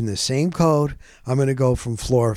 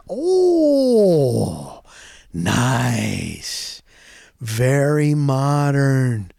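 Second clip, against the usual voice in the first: impressed; much slower than usual. Real, not acted. Impressed entering the apartment.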